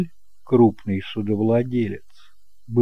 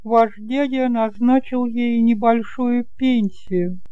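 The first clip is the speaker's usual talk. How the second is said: put-on higher and louder, a fussing elderly aunt; chatty kind aunt; 12 semitones higher, 3 dB louder than usual. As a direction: play the doting aunt, bright and eager to please. Aunt answers Gilles.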